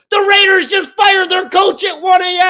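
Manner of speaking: high, loud, exasperated shout; fired-up shout. Real, not acted. Host ranting to co-host about the Packers, fired-up shout. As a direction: blast it out in sports-radio outrage. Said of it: "I'm all fired up".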